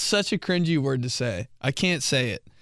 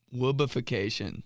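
ordinary delivery

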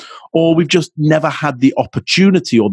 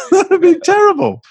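high pitched laughing through the words; laughing at himself; faster than usual. Real, not acted. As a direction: laugh it off, voice jumping way up. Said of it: "I wouldn't be able to stand myself".

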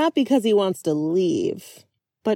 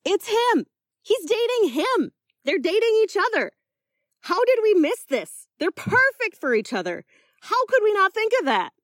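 shocked amazement; 12 semitones higher than usual. Acted. Lily shocked, amazed at couple.

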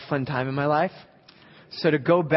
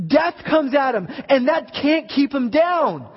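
full-throated sermon climax; preaching at full force; 9 semitones higher, 7 dB louder than usual. Acted. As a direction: preach it like a revival tent.

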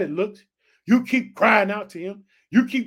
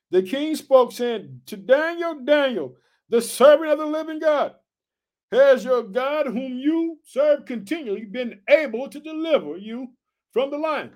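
raised, ringing scripture reading; preached scripture reading; 5 semitones higher, 3 dB louder than usual. Acted. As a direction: proclaim the verse to the church.

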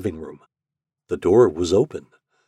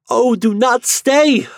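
much higher, louder, slow anguished cry; desperate cry; 9 dB louder, slower than usual. Acted. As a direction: cry out in despair. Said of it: "cried the poor girl".

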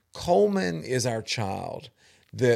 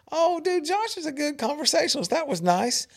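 high mock delight; 14 semitones higher than usual. Retold reply to the coach.

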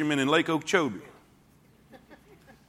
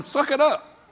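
bark "Suck it up"; 9 semitones higher, slower than usual. Retold or imitated. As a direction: throw "Suck it up" at him like a drill sergeant. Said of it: "Basically, he's telling him".

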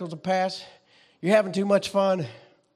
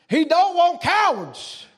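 preaching climax; 8 semitones higher, slower than usual. Acted. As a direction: hammer each word home to the church.

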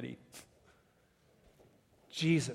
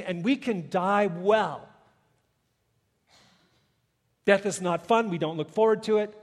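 louder and higher, proclaiming; preaching at full force; 6 semitones higher, 8 dB louder than usual. Acted. Preacher to the congregation, proclaiming Jesus's command. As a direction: let it ring, slowing on let there be life.

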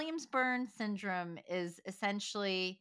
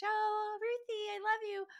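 very high, sing-song voice for the dog; high-pitched dog talk. Shown as a demonstration. Owner cooing to her dog Ruthie, very high pitched. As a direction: coo it way up high like to a pet. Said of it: "my high pitch".

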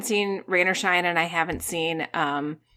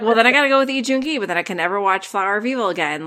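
rushed self-quote; much faster than usual. Retold or imitated. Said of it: "I'm like".

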